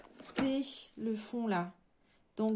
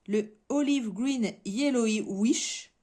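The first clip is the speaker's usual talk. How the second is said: "Olive Green Yellow Wish" slow and louder in English; English accent; 6 dB louder, slower than usual. Shown as a demonstration. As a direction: lay on the English accent for the colour name. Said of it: "vous allez pouvoir écouter mon accent". (accent anglais appuyé, lent et plus fort)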